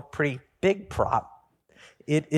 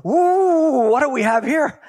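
higher, louder, fussy bureaucrat quiz; officious supervisor angel; 8 semitones higher, 5 dB louder than usual. Acted. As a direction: grill him like a pompous official. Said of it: "the supervisor angel comes and goes".

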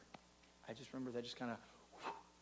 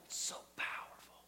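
whisper; 7 dB quieter than usual. Real, not acted. Awed aside after the prayer.

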